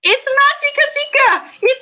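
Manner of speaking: very high insistent mock shout; mock indignant. Insisting on the name to her friend, very high mock outrage. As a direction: fire it back in high fake outrage. Playful squabble over a name.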